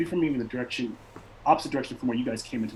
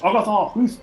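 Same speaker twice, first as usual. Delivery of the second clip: high, loud call across the camp; 11 semitones higher, 5 dB louder than usual. Acted. Barbarian leader calling to his warrior, loud high shout. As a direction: shout the name out high to send her off. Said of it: "he calls off in, like, a fluid language".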